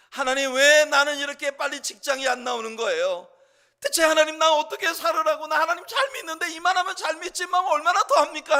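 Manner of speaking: builds from plain to a loud, high whine; childish complaining prayer. Acted. Believers grumbling to God for money and health, rising complaint. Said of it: "우리의 기도는 그렇게 다 유치하게 시작하는 거예요".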